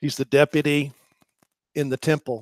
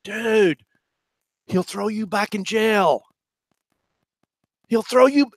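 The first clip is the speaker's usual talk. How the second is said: mock warning; 6 semitones higher, 4 dB louder than usual. Mock warning to Jeremiah again.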